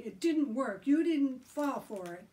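high, loud, argumentative; yelling. A narrator's reading. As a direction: yell your case at him. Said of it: "Joe yelled at Billy".

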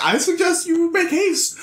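very high mock guide voice; insistent compass voice. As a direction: pipe up high to hurry the traveler. Compass hurries Tim along.

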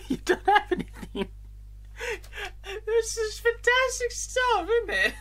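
laughing, squeaky high mock. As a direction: mimic him in a silly high voice. Mocking the shopkeeper, high.

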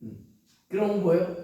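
usual speaking voice

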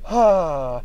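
breathy giggling fit; laughing. Real, not acted. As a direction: break down laughing. Laughing fit mid-sentence.

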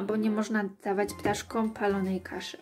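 usual speaking voice

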